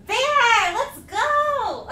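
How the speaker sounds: high, loud cheer; shouted, stretched-out hype; excited shout. Real, not acted. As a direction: holler it like a big celebration. Cheering a viewer's support.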